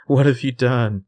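lightly hammed-up frantic mother; mock hysterical repeat. Echoing the mother's hysterical repeat, lightly frantic. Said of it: "She was hysterical".

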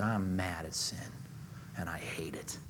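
soft, low whisper of God's anger; God's hushed voice. Acted. God declaring his hatred of sin, in a hushed whisper. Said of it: "he said".

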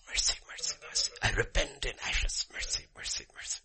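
rapid, repeated whispered pleas; whispered begging. Acted. Job answers God who has spoken, whispered begging. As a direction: whisper it as a cowed penitent.

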